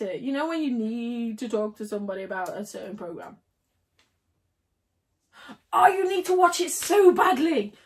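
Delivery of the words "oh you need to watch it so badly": higher, louder, insistent; gushing recommendation to a viewer; eager urging. Real, not acted. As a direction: plead with a friend to watch. Urging viewer to watch show.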